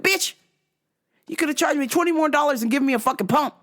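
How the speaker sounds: high, rapid-fire complaint; indignant retort. Retold or imitated. Buyer griping at the seller over a missing pump, indignant.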